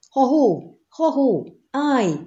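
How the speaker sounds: high, carrying halloo; calling out. A narrator's reading. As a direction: hail them from afar, pitched high. Rider calls out to halt knights. (magas, messzire szóló kiáltás)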